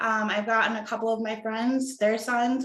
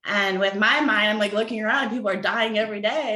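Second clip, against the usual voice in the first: ordinary delivery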